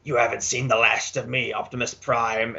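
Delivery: lightly imitated villain snarl; understated movie-villain parting threat; Galvatron. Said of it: "you know, he snarls".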